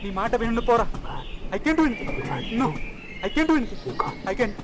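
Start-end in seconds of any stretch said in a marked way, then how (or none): 0.0-3.8 climbing higher with each insistent repeat; rising defiance. Acted. Arguing against whispered doubt, building. 3.9-4.2 breathy unvoiced whisper of "You can't"; whispered doubt. Inner doubting voice answers back.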